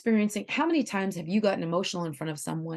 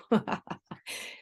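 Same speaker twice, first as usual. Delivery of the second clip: amused chuckle; short laugh; 3 dB quieter than usual. Real, not acted. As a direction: laugh at your own example. Laughs at own example.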